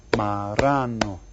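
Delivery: slow "Marrano", holding "rra"; stress on second syllable. Shown as a demonstration. Teacher asks where the accent falls, "Marrano" slow with "rra" held. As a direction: lean long on the middle of "Marrano". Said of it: "Which one is accent?".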